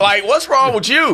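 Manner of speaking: high-pitched exasperated challenge; incredulous. Acted. Host asking the pretender what's wrong with her, incredulous. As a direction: confront them in disbelief.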